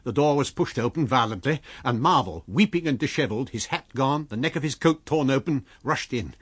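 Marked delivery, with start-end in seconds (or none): none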